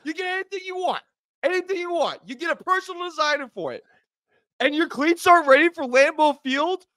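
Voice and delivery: exasperated rant. Real, not acted. Ranting about cleat sponsorships.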